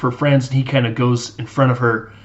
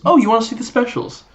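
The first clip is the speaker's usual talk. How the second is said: high, sing-song sarcastic reply; sassy waitress voice; mocking waitress; 10 semitones higher, faster than usual. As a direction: mimic him back with mock sweetness. Waitress mocks a rude customer.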